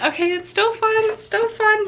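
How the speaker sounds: high, tense self-reassurance; shakily calming oneself down. Real, not acted. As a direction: talk yourself out of panic. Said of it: "let's keep this nice and fun".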